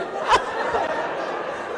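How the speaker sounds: breathy high laughing burst; unvoiced high laugh at the listeners; real laugh. Real, not acted. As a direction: laugh at the nosy crowd. Laughs again.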